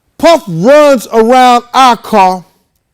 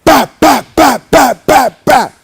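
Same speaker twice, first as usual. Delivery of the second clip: quick sharp "bap bap" gunfire sounds, higher; imitated gunshots; 3 semitones higher, slower than usual. Retelling the shooting outside the car, mock gunfire. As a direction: spit out the shots like pistol fire. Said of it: "you hear something go".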